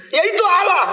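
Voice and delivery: exasperated shout. Retold or imitated. Young man snaps at pastor's question, high loud exasperation. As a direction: bark it back, annoyed.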